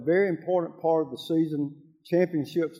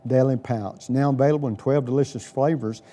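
louder, punchy sponsor ad read; 1950s ad; 5 dB louder than usual. Acted. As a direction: sell it like a radio commercial. Host reads sponsor ad.

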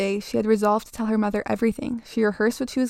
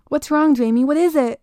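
urgent, raised worried cry; louder, higher, frantic mother's questions; alarmed; 5 semitones higher, 6 dB louder than usual. A narrator's reading. Frantic mother restraining thrashing child.

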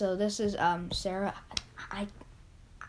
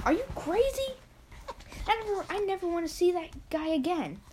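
high-pitched, incredulous protest; indignant refusal; 7 semitones higher, faster than usual. Acted. Petey rejects his kid's idea of visiting Grandpa, indignant. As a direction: act out a high, indignant outburst.

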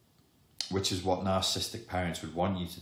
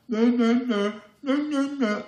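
put-on much higher and louder, squeaky childish no no no; inner critic impression; 9 dB louder, slower than usual. Inner critic refusing everything, squeaky much higher and louder. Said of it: "That's my mature impression of your inner critic".

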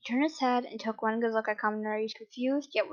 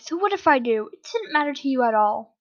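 defiant yell, louder; 3 semitones higher, 5 dB louder than usual. Acted. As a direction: shout back at him defiantly. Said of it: "him and yelled".

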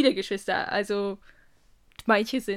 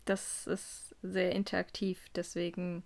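slow, soft deadpan monotone; 8 dB quieter, slower than usual. Real, not acted. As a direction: say it flat with no energy. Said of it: "Wie monoton du das gerade gesagt hast".

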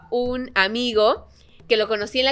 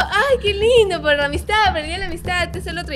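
fake cheerful; 7 semitones higher than usual. Dodging the boy's confession with fake higher cheer. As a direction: dodge it with fake sweetness.

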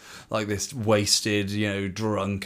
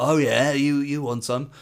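raised, louder mock challenge; hammed-up taunt, pitched up; acted challenge; 6 semitones higher, 5 dB louder than usual. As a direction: play a drunk squaring up for a fight. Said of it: "the guy's like".

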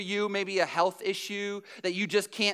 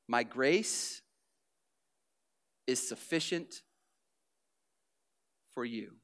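softer, lower and slow, with long pauses; soft God's voice; 9 semitones lower, 8 dB quieter than usual. Acted. God answers Paul's plea, soft and gentle.

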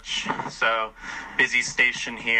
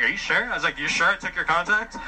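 higher, sceptical asking; doubtful question; 7 semitones higher than usual. Retold or imitated. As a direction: question her claim, half teasing. Doubting her claim.

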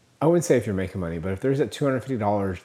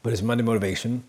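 chewing while talking; faster than usual. Real, not acted. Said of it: "Sorry if I'm chewing ice".

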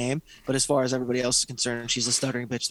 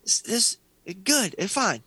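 lightly voiced soothing, much higher; mock consoling; 7 semitones higher, much slower than usual. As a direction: pitch it up, a hammed-up comforting coo. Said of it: "Telling this girl".

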